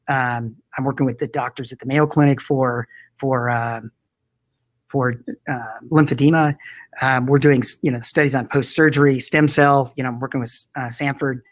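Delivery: ordinary talk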